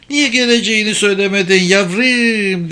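high, tearful mother's plaint; acted sniffling old mother, pitched high. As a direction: ask it as if starting to cry. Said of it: "burnunu çeke çeke ağlamaya başladı".

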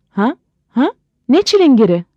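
high, baffled double-take; startled questioning. Acted. Husband hears of the locksmith tenant, startled and questioning. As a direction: blurt out puzzled questions, pitch jumping up.

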